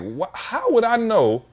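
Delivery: high, slow incredulous question. Retold or imitated. Retelling his answer to a confusing DMV letter, incredulous and high. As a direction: act baffled by the question.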